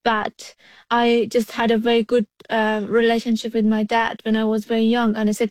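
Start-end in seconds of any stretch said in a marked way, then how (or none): none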